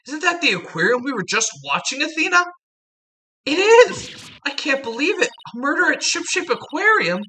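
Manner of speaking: high, startled heroine cry; shocked young woman voice. Acted. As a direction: gasp out the news in disbelief. Voices excited female character.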